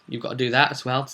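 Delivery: ordinary delivery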